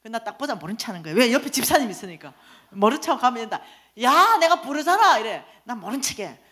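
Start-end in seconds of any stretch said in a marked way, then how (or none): 4.0-5.2 high, loud, drawn-out holler; mock indignant call; insistent calling. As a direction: holler after someone ignoring you. Acquaintance calling after her insistently.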